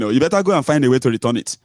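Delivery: usual speaking voice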